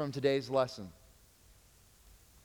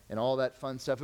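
ordinary talk, nothing marked

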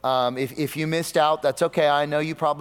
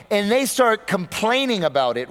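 usual speaking voice

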